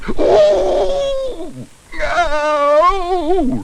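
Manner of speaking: high, drawn-out copycat howl; very high echoing cry; echoed yell. A narrator's reading. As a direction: howl it back eagerly. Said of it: "repeated Paddington".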